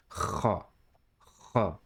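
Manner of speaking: "Kha" opening on strong throaty friction; throat-scraping kha. Shown as a demonstration. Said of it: "should feel like scraping the throat slightly".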